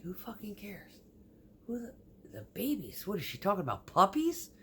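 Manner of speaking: whispered, bewildered aside; whispered disbelief, ending on a high squeak at puppies; baffled whisper. Retold or imitated. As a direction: hush it, baffled and incredulous. Retold baffled inner reaction.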